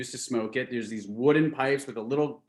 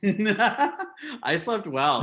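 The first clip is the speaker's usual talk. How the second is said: laughing; 9 semitones higher, 5 dB louder, much slower than usual. Real, not acted. Laughs while answering about sleep.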